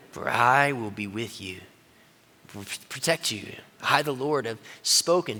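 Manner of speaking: a steady, hushed divine promise; solemn God speaking quietly. Acted. God answers Jeremiah.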